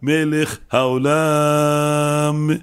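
long, held vowels on מלך העולם; careful intent recitation. Shown as a demonstration. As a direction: draw each word out very slowly. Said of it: "הוא כל היום זוכר את השם יתברך, והוא נזהר".

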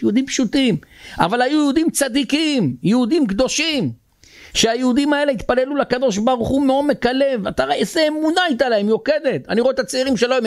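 high, emphatic sermon delivery; impassioned preaching. Real, not acted. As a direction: preach it with rising force, pitch held high.

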